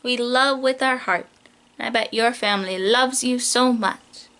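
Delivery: normal talking voice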